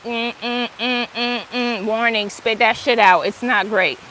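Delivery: low, soft, lightly voiced body's alarm; a hint of a wary inner voice rejecting food; body's warning voice. Her body refusing new food, lightly voiced low warning. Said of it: "my body will be like".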